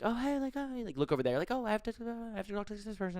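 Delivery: high, soft, fumbled party excuse; awkward excuse. Retold or imitated. Guest escaping small talk at a party, awkward excuse.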